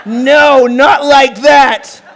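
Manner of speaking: slow, high, booming order; hammed-up furious parental ultimatum; exasperated parent shout. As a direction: thunder each word at the kids. Parent rejecting the forced apology.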